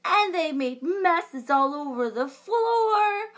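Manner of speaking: lively storybook read-aloud with big reactions; reading to children. A narrator's reading. Librarian reading a unicorn story to children, animated. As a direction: read it like a picture book for kids.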